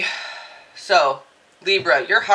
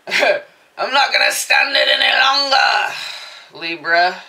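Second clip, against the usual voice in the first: defiant; 4 semitones higher, 8 dB louder, slower than usual. Pretend.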